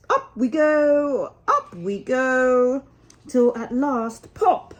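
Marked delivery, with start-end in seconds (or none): 0.1-2.8 slow, higher, lightly voiced little mole; muttering to himself. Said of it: "muttering to himself". 4.4-4.8 a much higher, louder sudden pop; popping out. Acted. Mole bursts into sunlight.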